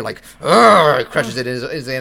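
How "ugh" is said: loud imitated grunt of renewed vigor; effortful growl. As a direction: growl as if summoning strength. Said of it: "get that renewed vigor".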